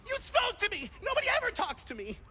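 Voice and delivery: high, fast excited chatter; overjoyed. Acted. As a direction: gush like nobody ever spoke to you. Lonely NPC thrilled to be addressed.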